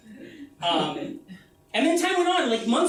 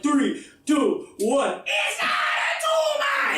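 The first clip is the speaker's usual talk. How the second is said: countdown into a shout; 6 semitones higher, much slower than usual. Retold or imitated. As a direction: count down then explode the line. Family countdown to famous line.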